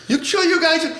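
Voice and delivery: very high-pitched checking-in voice; mock concerned fireman. Guilty fireman coming back around, high mock concern.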